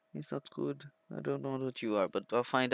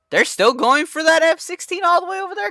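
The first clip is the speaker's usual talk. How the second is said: surprised; 4 dB louder than usual. Real, not acted. Surprised at distant dogfight.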